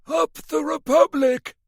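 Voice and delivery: put-on high, slow crackly old-man cackle; cackling old man. A narrator's reading. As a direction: crow it out like a gleeful old codger. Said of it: "the old man cackled".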